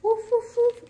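loud, high yappy woof woof; puppy barking. Acted. Biscuit the puppy barks loudly at the vet, high and yappy. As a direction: yap loudly like an excited puppy.

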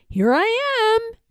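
high, slow singsong call; teasing call. A narrator's reading. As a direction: call out from a hiding place. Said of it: "it called".

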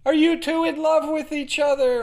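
high, loud woman's shout from the crowd. Acted. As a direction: call it out over the crowd. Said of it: "a woman shouted".

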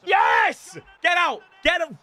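high yell of victory; see the loser off with high shouts; triumphant shout. Real, not acted. Gamer sending a beaten opponent packing, triumphant shout. Celebrating a win.

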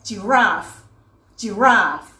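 "giraffe" as a careful, isolated example word; model pronunciation. Shown as a demonstration. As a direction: model "giraffe" for learners, ending on a clear f. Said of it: "อย่างเช่นคำว่า".

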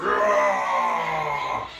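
imitated monster roar rising out of the water; drawn-out mock Godzilla roar. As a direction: roar long like a movie Godzilla. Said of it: "comes up out of the water like".

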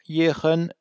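也很 held high and level; level high-pitched 也很; first tone. Shown as a demonstration. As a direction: keep both 也 and 很 up high without dipping. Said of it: "se prononcer au premier ton".

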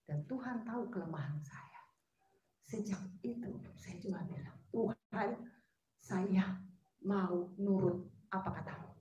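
soft, breathy whisper of surrender; whispered confession. Real, not acted. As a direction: whisper the prayer close and low.

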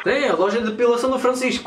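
put-on broken-Portuguese Russian accent. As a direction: play a lost Russian, heavy accent. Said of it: "com a famosa voz de russo".

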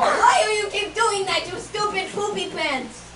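loud, high, drawn-out scolding; exasperated outburst. Real, not acted. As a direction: yell at a co-host ruining the take. Take interrupted, scolds co-host.

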